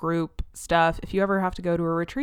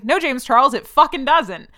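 higher and louder, a mock shouted comeback; loud, fed-up rebuttal to James Charles; exasperated retort; 6 semitones higher, 9 dB louder than usual. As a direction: snap back at the excuse with disbelief. Retorting to a YouTuber's excuse.

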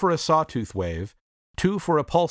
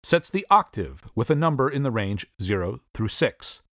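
ordinary talk, nothing marked

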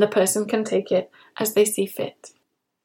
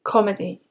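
Comedy stressed on COM, two syllables; stress on first syllable. Shown as a demonstration. Teacher models word stress for learners, first syllable hit. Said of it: "Stress the first syllable when you say comedy".

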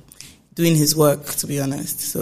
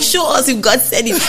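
higher, laughing challenge; laughing retort; 7 semitones higher, 4 dB louder than usual. Retold or imitated. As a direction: toss it back with a laugh.

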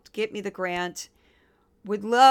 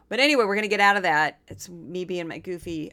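usual speaking voice

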